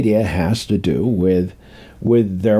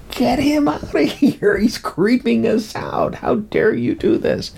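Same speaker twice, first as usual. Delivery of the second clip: villagers complaining; 11 semitones higher than usual. Retold or imitated. Villagers scolding the ghost's son.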